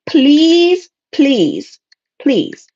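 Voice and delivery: first plea high, then dropping; exasperated plea. Real, not acted. Reader begs clients to think before speaking, exasperated pleading.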